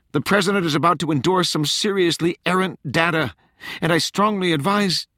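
high-pitched, pressing plea; urgent warning. A narrator's reading.

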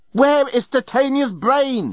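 high, drawn-out mock yell; furious yell. A narrator's reading. Journalist grabs Leovinus by the lapels, yelling. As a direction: stretch the demand out at high pitch. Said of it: "he yelled".

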